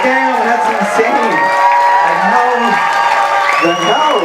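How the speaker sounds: slow, high, amazed; awed disbelief. Retold or imitated. Comedian recalling the packed garage, slow awed high voice. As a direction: draw it out like you can't believe it. Reacting to the huge crowd.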